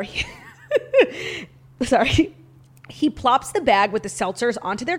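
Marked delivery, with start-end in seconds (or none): 0.3-1.4 high burst of giggles; giggling fit. Real, not acted. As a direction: break up laughing mid-sentence. Laughs while reading the letter.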